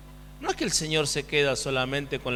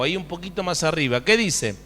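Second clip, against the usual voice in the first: ordinary delivery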